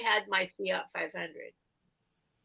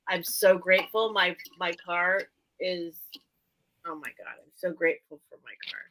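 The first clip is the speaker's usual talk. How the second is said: normal talking voice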